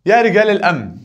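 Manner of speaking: يا رجال الأمن called out high and loud, stressing يا; loud vocative call. Shown as a demonstration. Teacher calls out the vocative to the class, loud and high. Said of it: "ده منادى".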